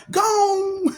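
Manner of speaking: high squeaky gone; mock auctioneer.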